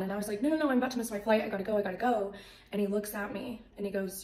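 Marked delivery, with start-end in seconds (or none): 0.4-2.3 rapid, raised, louder refusal; urgent brush-off, words tumbling out; hurried protest. Retold or imitated. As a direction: re-enact rushing off for a flight. Retold refusal, about to miss flight.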